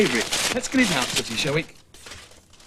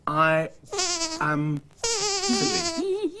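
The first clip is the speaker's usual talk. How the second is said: I Am Silly drawn out very slowly, higher, with a mock cry; mock dismayed wail; 5 semitones higher, 5 dB quieter, much slower than usual. Man realises the puppets' book is a joke on him, mock wail. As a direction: wail the name as the joke dawns on you. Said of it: "Who did I say wrote it?".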